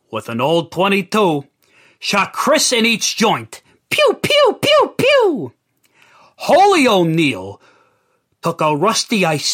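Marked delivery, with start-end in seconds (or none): 3.9-5.5 very high pew pew gunshot noises; playful gunfire sound effect; imitated gunshots. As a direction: spit out cartoon gun sounds. Narrator mimics gunshots.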